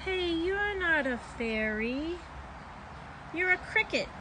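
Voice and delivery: put-on a child realising it's only a cricket; surprised child. A narrator's reading. Girl finds cricket, not fairy.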